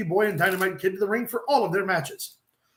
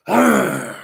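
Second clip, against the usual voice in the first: loud mock dog groan; hammed-up louder dog noise; 3 semitones lower, 8 dB louder than usual. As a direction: groan out like a straining bulldog. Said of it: "I hear the dog go".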